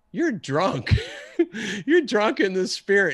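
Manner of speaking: high-pitched, laughing disbelief; amazed retold accusation. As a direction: blurt it out high, breaking into a laugh. Acting out his amazed reaction to friend.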